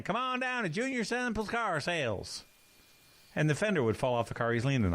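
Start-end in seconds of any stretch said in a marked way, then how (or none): 0.0-2.4 a hint of a hammed-up car salesman pitch, pitched higher; mock TV ad call, up high; imitating a hillbilly car lot ad, higher; lightly voiced pitchman.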